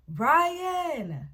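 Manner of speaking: loud, high call across the house; dad calling out. Retold or imitated. The dad calling his son from the living room, loud call. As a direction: shout the name like a dad down the hall. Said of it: "calling him from the living room".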